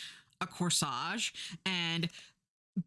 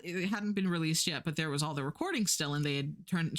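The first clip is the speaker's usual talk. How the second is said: ordinary delivery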